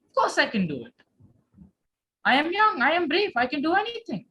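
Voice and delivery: brash, sure of himself, rising up as he goes; confident. Acted. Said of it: "He was really confident about his answer".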